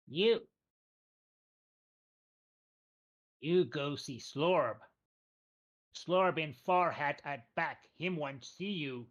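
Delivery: slow, deliberate goblin chief voice; slow goblin boss. Acted. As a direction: drag each word out, ominous. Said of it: "looks at him and says".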